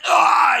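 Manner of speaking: high, falling cry of frustration; short reenacted wail of defeat; frustrated yell. Gamer dying at the final part, high frustrated cry.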